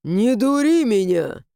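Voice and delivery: angry threat. Acted. Stranger threatens with sword.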